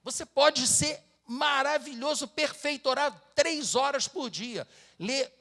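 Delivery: fiery sermon climax; preaching at full force. Acted. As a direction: drive the warning home to the congregation.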